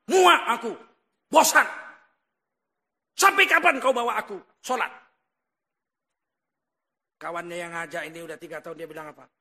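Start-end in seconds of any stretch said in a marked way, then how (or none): 0.1-4.9 loud, fed-up outburst; acted angry coworker. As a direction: blast out exasperation at a nagging friend. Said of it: "Marahlah kawannya sekantor ini". (bentakan marah karena muak)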